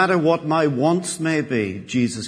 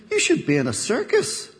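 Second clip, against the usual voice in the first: high-pitched acted excitement; amazed barman; 10 semitones higher than usual. Barman advises the talking penguin, acting amazed. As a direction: pitch it right up, lightly playing astonishment.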